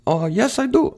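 put-on a light sketch of the Korean girl answering; a hint of Nana's voice; imitating Nana. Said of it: "She said".

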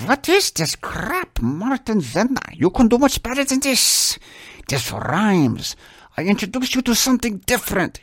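a hammed-up Russian-accented teacher; mock Russian accent. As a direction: play the nun with a Russian accent. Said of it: "She was Russian".

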